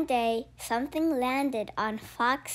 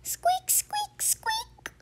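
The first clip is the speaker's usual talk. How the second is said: very high breathy squeaks; tiny squeaks; slower than usual. Acted. Baby creature squeaking on Fox's head.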